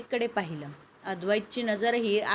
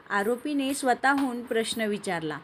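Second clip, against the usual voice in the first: ordinary delivery, nothing marked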